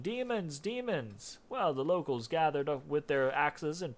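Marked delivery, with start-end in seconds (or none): 0.0-1.4 high, drawn-out mock yell; restrained yelling. A narrator's reading. Ginty fleeing naked to the village, restrained yell. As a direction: cry the alarm without full volume. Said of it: "running and yelling".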